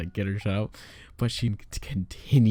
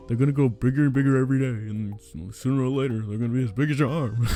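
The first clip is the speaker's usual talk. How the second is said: fast, mocking rant; repetitive nagging; 3 semitones higher, 3 dB louder, faster than usual. Employee repeating her warning about the fish, mock nagging. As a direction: imitate someone rattling on insistently, raised at the start. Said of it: "how many times she repeated that".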